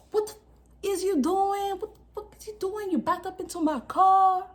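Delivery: high-pitched imitation of an angry man; mock outraged driver. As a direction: act out the furious car owner, voice high.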